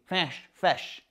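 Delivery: FISH said wrongly through the nose, near "fash"; nasal fish. Shown as a demonstration. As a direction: push air up the nose as you say "fish". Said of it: "you're using your instrument wrong".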